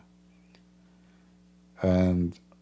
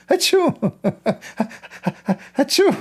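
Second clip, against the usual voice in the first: fake sneeze; much faster than usual.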